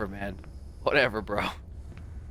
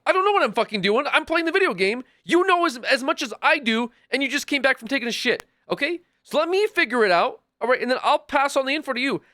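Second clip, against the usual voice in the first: raised, higher exasperated rant at chat; 8 semitones higher, 3 dB louder than usual. Real, not acted. Streamer ranting at a viewer who came back, exasperated and raised. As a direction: vent at the viewer, voice pitched up. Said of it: "He's yelling".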